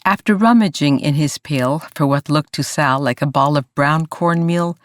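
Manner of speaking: usual speaking voice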